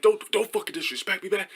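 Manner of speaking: high-pitched imitated warning; mock heated argument, no real shouting; mock arguing. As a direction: warn someone in a put-on high angry voice. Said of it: "we were just going back and forth".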